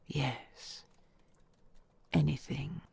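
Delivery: usual speaking voice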